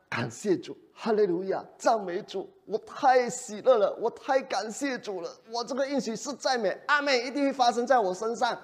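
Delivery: high, overjoyed hallelujah gushing; mock ecstatic praise. Preacher imitating a joyful, pious Abraham. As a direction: pretend to burst with holy joy.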